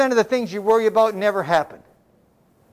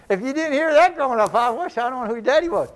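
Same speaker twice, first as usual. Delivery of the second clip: ordinary talk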